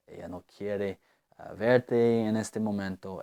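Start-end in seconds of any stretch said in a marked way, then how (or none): none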